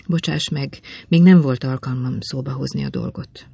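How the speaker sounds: plain reading voice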